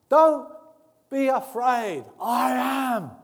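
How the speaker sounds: high, loud shout across the waves; calling over the storm. Acted. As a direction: shout it over the wind to the boat. Jesus calls over the storm.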